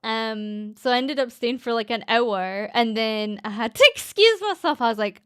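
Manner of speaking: usual speaking voice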